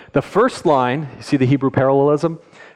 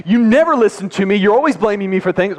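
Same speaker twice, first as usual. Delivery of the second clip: higher, louder accusing outburst; mock angry accusation; 7 semitones higher, 3 dB louder than usual. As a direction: imitate yourself snapping at your wife mid-fight. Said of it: "I'm like".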